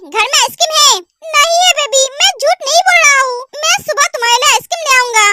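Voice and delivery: normal talking voice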